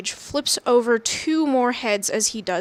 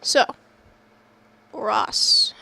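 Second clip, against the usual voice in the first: ordinary talk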